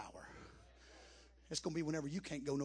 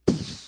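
loud burst of a mock wave splash; loud poof; 17 dB louder than usual. Sailor telling the church how waves hit, loud mock poof. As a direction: blurt it like a wave hitting.

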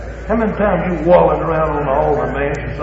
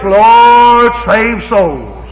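mock vague prayer; 5 semitones higher, 8 dB louder, much slower than usual. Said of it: "Or maybe it's like this".

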